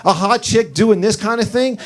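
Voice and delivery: disbelief. Retold or imitated. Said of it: "I'm like".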